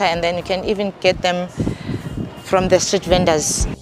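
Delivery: usual speaking voice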